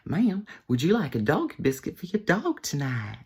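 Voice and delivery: high, over-polite drive-thru server voice; hammed-up polite server; eager server. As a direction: offer it in a high customer-service lilt. Repeats the offer to lady.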